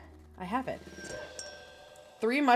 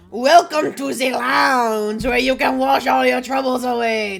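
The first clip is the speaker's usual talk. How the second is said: put-on slow, booming French-accented bartender; 2 semitones higher, 10 dB louder, slower than usual. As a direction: welcome them in a hammed-up French accent. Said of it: "awfully fucking French".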